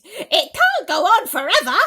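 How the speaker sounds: high, loud exclaim; crying out. A narrator's reading. Aunt marvels the peach keeps growing, crying out. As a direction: cry it to the tree. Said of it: "Aunt Spiker cried".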